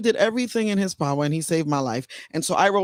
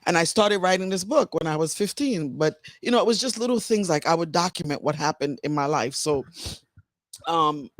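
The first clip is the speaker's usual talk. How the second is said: ordinary talk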